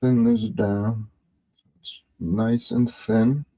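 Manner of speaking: usual speaking voice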